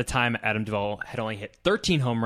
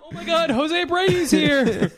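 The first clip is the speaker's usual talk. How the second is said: mock alarm; 4 dB louder than usual.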